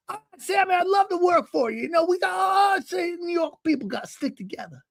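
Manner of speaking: put-on very high, chummy old-man voice; butter him up in falsetto; eager older man. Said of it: "It's how he talks".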